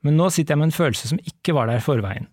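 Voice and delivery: measured read-aloud narration; story reading. Acted. Host reads the book's ending to co-host, story reading. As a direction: read the novel out clearly and evenly.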